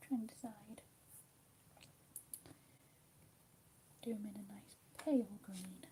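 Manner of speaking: hushed ASMR whisper. Acted. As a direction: whisper gently, as if not to break the calm. Whispered colouring decisions.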